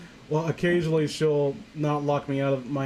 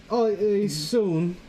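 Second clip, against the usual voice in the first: lightly voiced Jeff; 3 semitones higher than usual. Jeff voiced in story reading.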